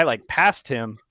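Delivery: ordinary talk, nothing marked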